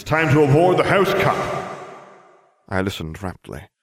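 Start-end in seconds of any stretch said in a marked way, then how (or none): 0.0-1.4 loud, high headmaster's proclamation; raised, declamatory announcer delivery; ceremonial announcement. A narrator's reading. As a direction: announce it to a whole hall.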